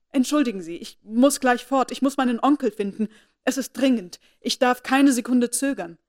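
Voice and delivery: put-on distressed young woman, urgent and loud; agitated. A narrator's reading. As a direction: rush the apologies, desperate to leave. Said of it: "so aufgeregt".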